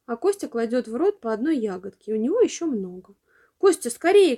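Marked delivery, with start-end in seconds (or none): 3.6-4.3 put-on impatient girl's shout, higher and louder. A narrator's reading. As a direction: snap at him to hurry. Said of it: "кричит она".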